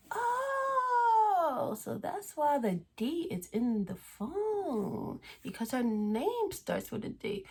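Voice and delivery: very high, slow mock realization; high drawn-out aha, retold; sudden realization. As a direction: it all clicks into place. Realizing the caller's identity.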